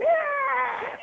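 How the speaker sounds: a high squeal, like a mouse caught by a snake; imitated mouse squeal. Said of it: "It yelled, squealed, like".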